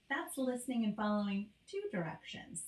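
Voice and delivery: ordinary talk, nothing marked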